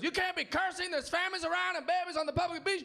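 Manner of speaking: put-on high-pitched scolding in a Mexican accent; yelling Mexican guy. Comic as local man scolding a swearing tourist, yelled in Mexican accent. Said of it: "this Mexican guy starts yelling at me".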